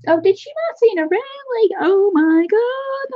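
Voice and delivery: high-pitched excited squeal, stretched at the end; super excited. Real, not acted. Streamer reacts to Martina's surprise news, super excited. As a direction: let the thrill send your voice way up. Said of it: "I'm super excited".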